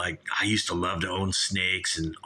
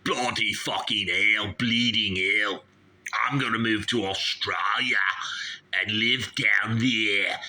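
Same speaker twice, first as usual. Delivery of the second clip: put-on Australian accent. Host threatens to move to Australia, hammed-up Australian accent. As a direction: draw out the Australian vowels. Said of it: "You're bloody talking like me".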